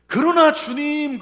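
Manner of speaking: very loud, high and slow cry; fervent cry. Acted. Psalmist turning to God, loud slow cry. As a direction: cry out to the Lord with everything.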